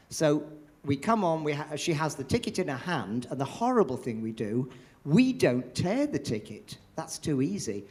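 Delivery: normal talking voice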